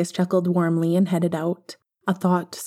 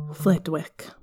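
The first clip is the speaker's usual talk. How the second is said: plain reading voice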